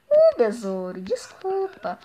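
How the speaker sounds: normal talking voice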